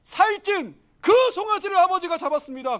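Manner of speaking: high, loud servant's announcement; servant reporting. Acted. Servant reporting the feast to the elder son, announcing. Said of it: "종을 시켜서 알아보라고 했더니 뭐래요".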